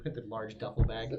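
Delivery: ordinary talk, nothing marked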